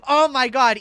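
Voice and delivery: loud, high burst of delight; thrilled at the new joke; delighted outburst. Real, not acted. Finding an even funnier bit, excited outburst.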